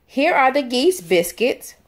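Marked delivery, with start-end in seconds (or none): none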